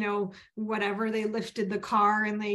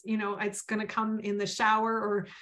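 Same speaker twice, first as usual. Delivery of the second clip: ordinary delivery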